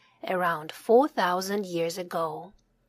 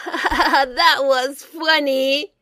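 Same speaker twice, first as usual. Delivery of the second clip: amused; 5 semitones higher, 7 dB louder, much slower than usual. A narrator's reading. Sam laughing at villagers.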